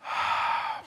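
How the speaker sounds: long, audible exhale; deep breath out. Shown as a demonstration. Pastor leading congregation into prayer, deep exhale. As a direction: blow the breath out slowly. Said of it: "take a deep breath".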